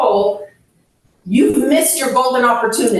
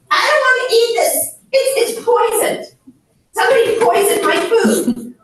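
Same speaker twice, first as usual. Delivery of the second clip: put-on high, fast, frightened old woman voice; mock-frightened elderly patient, pitched up; paranoid old mother; 10 semitones higher, faster than usual. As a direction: squeak out the poison fear like a confused mother.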